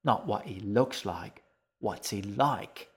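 slow, sharp impatient hiss. A narrator's reading. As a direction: spell it out, annoyed and slow. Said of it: "he hissed at her".

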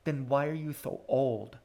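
plain reading voice

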